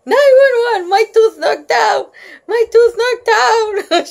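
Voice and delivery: put-on high, loud hysterical child; frantic wailing to the whole street; shouting. A narrator's reading. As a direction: shriek for emergency help, half laughing. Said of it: "I kept running and shouting".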